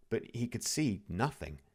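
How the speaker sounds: plain reading voice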